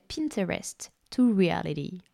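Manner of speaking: put-on American English accent. Shown as a demonstration. Announcing her masterclass title, playful American accent. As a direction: say it in your finest American accent. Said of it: "Avec mon plus bel accent américain".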